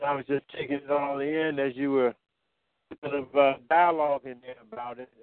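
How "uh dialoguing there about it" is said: usual speaking voice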